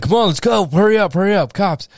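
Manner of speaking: high, loud, hurried call to run; urgent shout. Retold or imitated. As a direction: yell at your buddy to move now. Urging friend to flee from cops.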